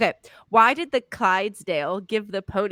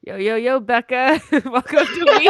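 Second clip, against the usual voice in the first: rising, louder mock hype opener; dude intro; 3 semitones higher, 6 dB louder than usual. Host greeting Becca at the show's start, dude intro. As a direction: open like a dude, breaking into laughter. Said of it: "that's such a dude intro".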